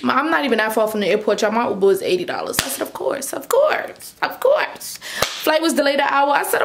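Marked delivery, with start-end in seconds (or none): 2.8-5.0 high, airy mock acceptance; hammed-up high-pitched exasperation; sarcastic resignation. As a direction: squeak it out, exasperated at your luck. Mock resignation at piling-up bad luck.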